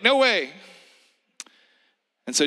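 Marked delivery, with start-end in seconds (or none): 0.0-0.5 high loud mock refusal; Peter refusing. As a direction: blurt it in a comic high voice. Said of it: "He's like".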